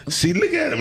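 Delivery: a proud auntie pointing out her nephew; auntie's teasing voice. Retold or imitated. Auntie showing off her nephew's Detroit talk, high and teasing. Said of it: "She be like".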